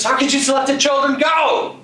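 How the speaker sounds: high, loud shriek of rage; furious shout. Acted. The witch screams at her cat, furious high shout. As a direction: yell at the cat who betrayed you.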